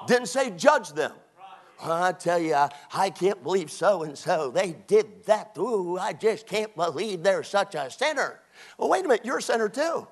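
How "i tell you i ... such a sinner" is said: judgmental church gossip. Acted. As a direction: mimic a sanctimonious gossip tut-tutting over a sinner.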